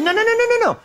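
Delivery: high, rapid string of no's; panicked brush-off; frantic denial. Acted. Peter frantically denying to the girl, high-pitched.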